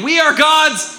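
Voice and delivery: shouted, high call for the crowd to answer. Shown as a demonstration. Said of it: "say it again".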